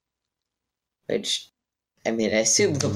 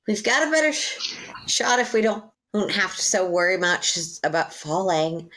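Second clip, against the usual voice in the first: much higher character voice, in-character; 6 semitones higher than usual. Acted. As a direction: speak as the character Scissors. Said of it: "Scissors is gonna".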